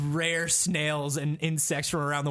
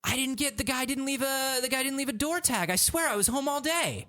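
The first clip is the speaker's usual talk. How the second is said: high-pitched excuse-making; high, insistent denial; acted defensive protest; 9 semitones higher than usual. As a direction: plead your innocence in a raised pitch. Defensive customer in acted call.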